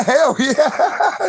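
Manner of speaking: loud high celebration with laughter; jubilant whoop. Real, not acted. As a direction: cheer like you just won.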